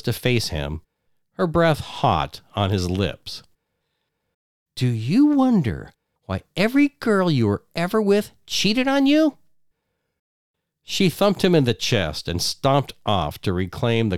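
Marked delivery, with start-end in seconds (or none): 4.8-9.4 high, cutting wife voice; cruel taunt. Acted. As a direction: twist the knife, up high. Wife taunts him face to face.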